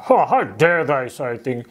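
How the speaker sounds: hammed-up huffy outrage; put-on indignant bluster. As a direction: play mock-offended, huffing disciples. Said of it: "they began to be indignant".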